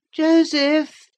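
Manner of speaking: put-on high, loud petulant boy calling from another room; peevish cry. A narrator's reading. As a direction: whine the name out loud and high. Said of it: "cried a peevish voice".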